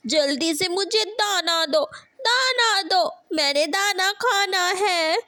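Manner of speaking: high wailing demand; crying for food. Acted. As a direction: whine and wail for grain. Hungry child cries for food. (रोते हुए खाना माँगना)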